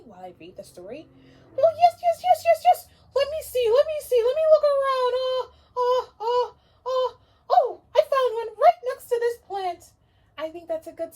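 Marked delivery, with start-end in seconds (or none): 1.6-9.9 very high, loud, gushing owl; excited puppet owl. Acted. Owl puppet thrilled about the story, squealing. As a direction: squeal with joy over story time.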